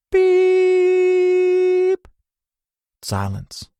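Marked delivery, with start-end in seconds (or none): 0.1-2.1 very high held tone; long flatline beep. A narrator's reading. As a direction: hold a long flat beep. Monitor flatline.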